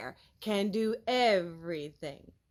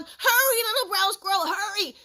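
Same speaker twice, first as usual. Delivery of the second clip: high, loud call from afar; granny calling her grandson to hurry; 9 semitones higher, 7 dB louder than usual. Acted. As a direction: call out to the squirrel. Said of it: "she was calling".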